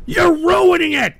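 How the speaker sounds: loud, high accusing yell; angry shout. Real, not acted. Host blaming trolls for ruining his show, angry shout. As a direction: holler the accusation.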